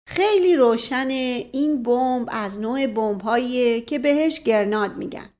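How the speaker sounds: ordinary delivery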